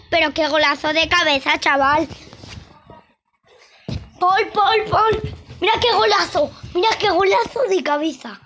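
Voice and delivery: high, loud goal-call excitement; breakneck goal commentary. Acted. Celebrating a headed goal.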